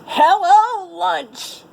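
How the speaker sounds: big wolf's high, loud greeting; shouted. A narrator's reading. Wolf greeting the fleeing animals with a shout. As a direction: call out to your lunch. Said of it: "a big wolf shouted".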